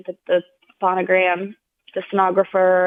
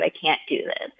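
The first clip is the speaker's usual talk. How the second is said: much softer, falling away, "I can't do this" almost dropped; defeated, quiet; 3 semitones lower, 8 dB quieter than usual. Retold or imitated. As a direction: let "I can't do this" sink to a near-whisper of despair. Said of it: "this is so deep and so hard".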